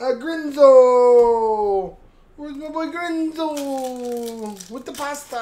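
hammed-up mock Italian accent, drawn way out; playful, stretched-out fake Italian accent; bad mock accent. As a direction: call out long, high vowels in a badly faked Italian accent. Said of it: "good accent".

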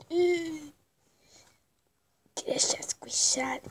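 slow whisper. Real, not acted. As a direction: hush it out slowly. Whispered comment.

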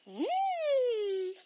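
squeaky mmm of delight; a high hum of yum; high yummy mm. Pretend. Yummy food reaction.

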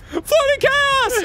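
very high, slow panicked shout; mock scream. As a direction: scream it like a crowd fleeing the pool.

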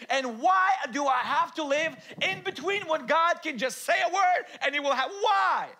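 loud, high, shouted sermon; preacher building to a shout; full-force preaching. Acted. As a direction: preach it out at the top of your voice.

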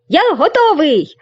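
high bright ready call; eager exclaim. Acted. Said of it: "він вигукнув". (бадьорий високий вигук)

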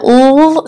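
"L" as a sustained "ull" sound; letter sound. Shown as a demonstration. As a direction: sound out "L" instead of naming it "el". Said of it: "To spell that L sound".

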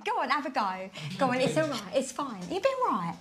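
normal talking voice

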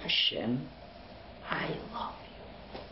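hushed, slow, heartfelt whisper; most emotional voice. Shown as a demonstration. Preacher showing the congregation how to tell God I love you, whispered. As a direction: pour out love in a breathy hush. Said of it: "with the most emotional voice you could drum up".

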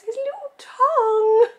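very high, slow, laughing squeal; high squealing gush, loud and drawn out; cute-overload squeal. Real, not acted. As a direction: squeal it through laughter, overwhelmed. Gushing over cute pug.

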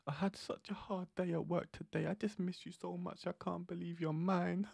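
mocking a simp sweet-talking his girl. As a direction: ham up a clingy boyfriend. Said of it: "you're there like".

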